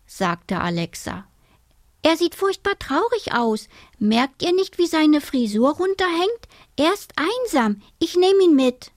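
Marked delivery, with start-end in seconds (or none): 2.0-8.9 put-on high-pitched, insistent child; pleading girl. A narrator's reading.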